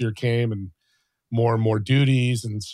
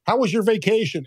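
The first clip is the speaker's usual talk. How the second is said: higher-pitched, chirpy acted hellos; cheery mock greeting; 8 semitones higher than usual. Young desk worker greeting wealthy gym regulars, cheerily.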